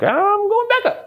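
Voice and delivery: very high, put-on voice; comic high squeal. Storyteller quoting himself volunteering after everyone quit, comic squeal. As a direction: sing it out in a silly falsetto.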